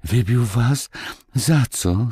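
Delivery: put-on high-pitched, mildly alarmed child; frightened question. A narrator's reading. Marysia fears the boys were beaten, asking in mild alarm. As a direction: ask with a touch of fright. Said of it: "spytała Marysia z przestrachem".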